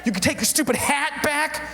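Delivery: high-pitched indignant tirade; angry rant. Acted. Fed-up performer handing back hat, rabbit and scarf, high angry rant. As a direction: indignantly reject each thing in turn.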